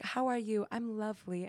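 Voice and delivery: soft, precious poetic greeting; mock poetic. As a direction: imitate a poetic type, softly. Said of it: "like, very poetic".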